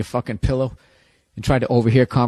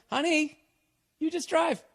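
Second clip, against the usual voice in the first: boyfriend, mock high; 14 semitones higher, 5 dB quieter, slower than usual. Boyfriend voiced in breakup bit.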